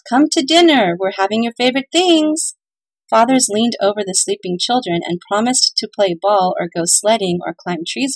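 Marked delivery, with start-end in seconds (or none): 0.1-2.5 higher, louder call from the kitchen. Acted. Mothers calling sleeping children to dinner, loud. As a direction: call them to dinner across the house.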